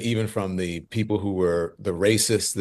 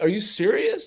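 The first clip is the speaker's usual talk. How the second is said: high, astonished question; can't believe your luck; surprised disbelief; 13 semitones higher than usual. Retold or imitated. Disbelief at winning election.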